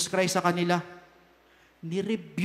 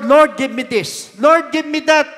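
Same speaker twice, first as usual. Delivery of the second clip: demanding prayer; 5 semitones higher, 8 dB louder than usual. Acted. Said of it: "Pag sinabi mo sa Lord".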